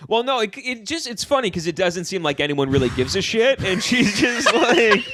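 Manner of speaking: high, tense, annoyed voice; mad tone. Real, not acted. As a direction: fake cheerful but mad. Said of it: "Anytime you say it's funny in a mad tone".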